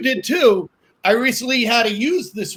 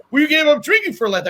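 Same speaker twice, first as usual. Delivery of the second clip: higher, quick mock-indignant protest; retold exasperation; 6 semitones higher, faster than usual. Friend challenging him over Lent drinking, mock exasperated. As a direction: imitate an exasperated friend, fast and pitched up. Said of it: "a friend of mine got really angry".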